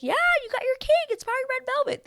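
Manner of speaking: very high, placating imitation; high-pitched mock enthusiasm; humouring. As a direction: mimic someone humouring her in a squeaky-high voice. Bachelor humouring her over the cake.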